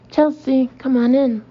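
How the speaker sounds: calling a dog. Retold or imitated. Neighbor calling his dog.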